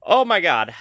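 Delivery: high-strung and unsettled; shaken up. Real, not acted. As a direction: report it while still shaken by a scare. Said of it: "I am shaky as all can be".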